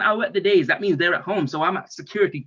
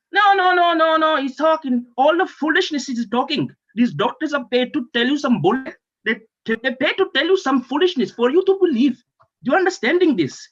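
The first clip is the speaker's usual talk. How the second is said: high, insistent protest; indignant rant; 6 semitones higher, 4 dB louder than usual. Acted. Patient dismissing doctors' foolishness, loud indignant rant. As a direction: launch loudly into a heated denial.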